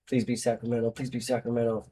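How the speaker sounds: very soft muttered wish, repeated; hushed pleading. Real, not acted. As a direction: plead under your breath. Hoping aloud while checking venue.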